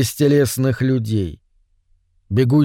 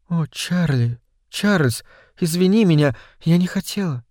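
soft, higher-pitched woman's voice; apologetic wife; 4 semitones higher, 3 dB quieter than usual. A narrator's reading. Wife apologising to sobbing Чарли, soft pleading. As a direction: apologise gently, hushed and pleading.

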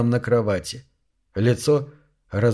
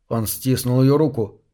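plain reading voice

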